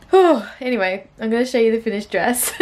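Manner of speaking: usual speaking voice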